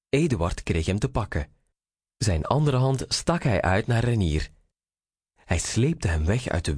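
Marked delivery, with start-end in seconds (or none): none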